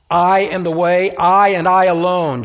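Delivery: loud, forceful stress on each "I"; emphatic. Acted. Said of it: "It begins emphatically".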